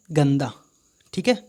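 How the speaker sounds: ordinary talk, nothing marked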